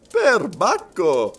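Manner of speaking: loud, drawn-out exclamation with a smile; amused exclamation. A narrator's reading. Servant answering the detective's confidence, amused exclamation. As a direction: stretch it out high and jovial. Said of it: "disse Passepartout sorridendo".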